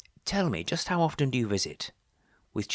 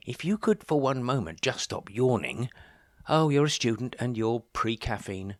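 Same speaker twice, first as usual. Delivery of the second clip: ordinary delivery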